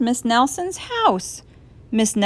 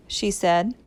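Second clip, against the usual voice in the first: ordinary delivery, nothing marked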